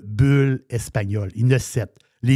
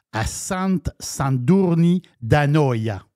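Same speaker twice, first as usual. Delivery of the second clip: slow, careful Spanish-accented place name; much slower than usual. Shown as a demonstration. Host naming a Catalan town for co-host, Spanish accent. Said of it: "c'est mon accent espagnol".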